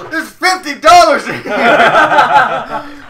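high, loud, slow mock horror; hammed-up outrage at the find; shocked dad. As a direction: gasp then drag it out in disbelief. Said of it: "he, like, searches her backpack, like".

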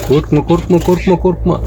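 calm the little bird down; soothing a pet. Real, not acted. (kuşu sakinleştiren yumuşak ses)

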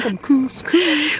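high, drawn-out singsong; teasing, very slow high-pitched repeat; playful echo. Acted. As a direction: stretch it out high in mock fancy echo. Playfully echoes partner's "couscous.

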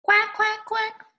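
high-pitched, quick quacking; duck quacks. Acted. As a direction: quack like a duck for little children. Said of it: "and ducks go".

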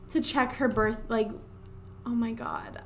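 ordinary talk

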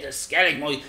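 ordinary talk

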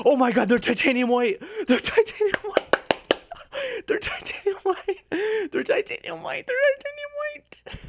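very loud, very high screams of joy; elated high shrieking; ecstatic screaming. Real, not acted. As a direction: shriek it like you just won. Rare item revealed.

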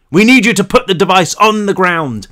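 loud, high order over the noise; police shout. A narrator's reading. Cop ordering Brick to drop the device, loud shout. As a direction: shout the demand over rotors. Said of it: "the man shouted".

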